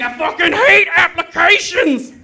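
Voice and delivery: high, loud yelled complaint, laughing; mock furious yell. Customer ranting down the phone, high laughing yell. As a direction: shriek the hatred of apps.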